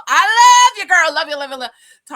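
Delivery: high, loud gushing shout; exuberant sign-off. Real, not acted. Host signing off to viewers, shouting love. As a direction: sing out affection at full volume.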